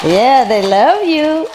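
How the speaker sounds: cooing. Real, not acted. Cooing to bird.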